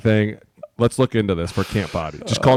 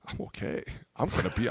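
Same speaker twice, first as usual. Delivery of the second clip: softer, breathy, fighting back tears; choked-up whisper; 15 dB quieter than usual. Retold or imitated. Retold choked-up goodbye to players.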